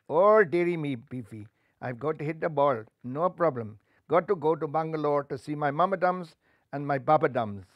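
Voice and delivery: comic Indian voice; little Rajban talking cheerfully; Indian accent. Acted. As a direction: chatter it with a sing-song lilt. Indian player speaks.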